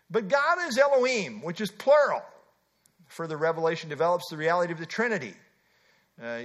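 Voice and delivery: ordinary delivery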